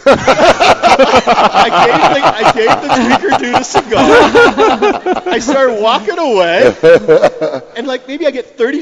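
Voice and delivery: real laughter bubbling through, slow; laughing. Real, not acted. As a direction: crack up while telling it. Laughing through telling the punchline.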